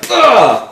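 loud, high guttural grunt; like a punch to the face; harsh grunt. Retold or imitated. Mimicking how German sounds to him, loud harsh grunt. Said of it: "it just sounds like".

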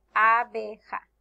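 abeja as a - be - ja, in separate beats; syllable by syllable. Shown as a demonstration. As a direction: break abeja into three firm syllables. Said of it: "Tiene tres sílabas".

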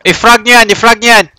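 shouted urgent order; combat callout. Real, not acted. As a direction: bark the command over gunfire. Ordering grenades in firefight.

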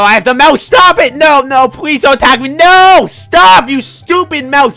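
high, loud frantic begging; panicked pleading. Real, not acted. Gamer pleading loudly with a mouse to stop attacking. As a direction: shout in panic.

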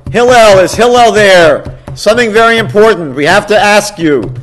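loud, high, urgent calling; calling out at the door. Acted. Man at the door calls for Hillel, loud and high. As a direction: shout up to the house so he'll come down.

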